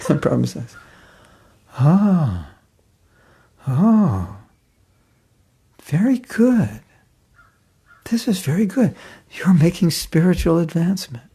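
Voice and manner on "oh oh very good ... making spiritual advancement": put-on high, approving old teacher's voice; cheerful guru. Prabhupada cheerfully praises the despairing wife, high and approving. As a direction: praise her despair as if it's good news.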